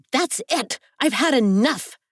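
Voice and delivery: high, fed-up outburst; yelling. A narrator's reading. Telling off the watchers behind the smashed mirror, yelling. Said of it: "I yell".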